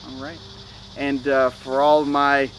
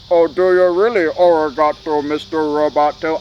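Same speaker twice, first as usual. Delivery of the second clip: louder mocking reply in a put-on voice; sarcastic put-on voice, louder; mocking robot voice; 5 dB louder than usual. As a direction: tease them like a robot would talk back. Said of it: "I don't talk like that".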